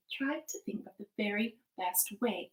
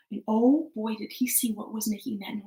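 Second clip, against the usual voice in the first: plain reading voice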